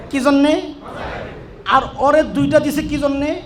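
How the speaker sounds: ordinary delivery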